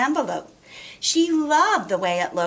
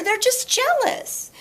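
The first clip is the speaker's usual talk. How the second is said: much higher, gentle mother voice; sweet mother. Acted. Mother comforting Chrysanthemum, high and gentle. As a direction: soothe like a loving mother.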